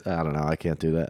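normal talking voice

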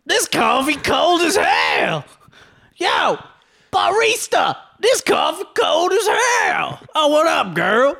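very high, drawn-out hammed-up holler. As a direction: holler like a crude caricature complaining to staff. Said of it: "and I'm offensive".

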